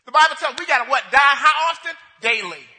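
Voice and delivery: loud preacher's question to the congregation; call and response. Acted. Preacher calling out to the congregation, loud and rising. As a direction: call out for the answer.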